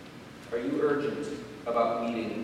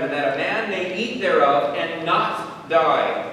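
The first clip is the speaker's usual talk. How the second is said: fiery preaching; 6 semitones higher, 8 dB louder than usual. Acted. Pastor pressing the congregation to believe, loud fiery preaching. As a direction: preach it at full force to the pews.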